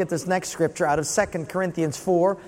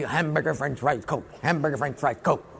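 lower, softer, halting repetition; 7 semitones lower, 6 dB quieter than usual. Retold or imitated. Student repeating the words back, lower and softer. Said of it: "So he".

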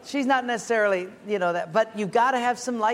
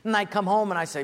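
usual speaking voice

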